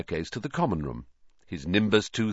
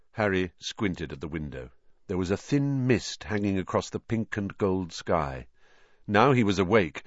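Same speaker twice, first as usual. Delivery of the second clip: usual speaking voice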